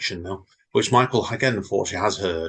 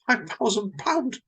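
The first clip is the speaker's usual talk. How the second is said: slow, much higher, stunned repeat of the sum; shocked; 12 semitones higher, much slower than usual. A narrator's reading. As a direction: let the number hit you in disbelief. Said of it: "Bob said in shock".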